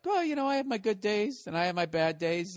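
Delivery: high put-on voice, sinking as it goes; mock cracking voice. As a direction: imitate a man about to cry, starting very high. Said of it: "then his voice cracks".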